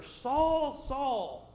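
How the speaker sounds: first Saul high and loud, second falling; urgent double call. Acted. Jesus calls Saul on the road, urgent call. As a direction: call out like a voice from heaven. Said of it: "this is serious business".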